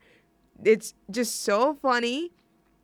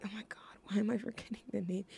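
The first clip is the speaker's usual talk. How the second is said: unvoiced frantic whisper; real flustered whisper; whisper to self; 11 dB quieter, faster than usual. Real, not acted. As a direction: whisper it while searching memory. Muttering, trying to recall name.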